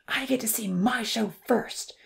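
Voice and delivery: breathy stage whisper, eager; loud whisper. A narrator's reading. Odin to his friends at midnight, loud whisper. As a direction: whisper it loudly, unable to wait. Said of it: "Odin was whispering loudly".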